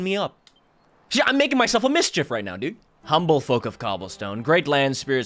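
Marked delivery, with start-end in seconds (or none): 3.0-5.3 low, measured blessing; solemn ceremonial reading. Acted. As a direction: recite it like an old village blessing.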